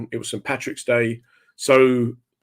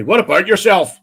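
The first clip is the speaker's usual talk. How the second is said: high, loud Northern Irish greeting; punchy Northern Irish catchphrase; Northern Irish accent; 8 semitones higher, 7 dB louder than usual. Acted. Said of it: "I love the accents".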